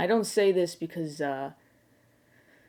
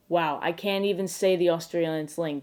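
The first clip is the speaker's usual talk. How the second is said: ordinary talk, nothing marked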